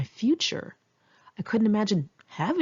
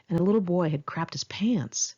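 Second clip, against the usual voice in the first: usual speaking voice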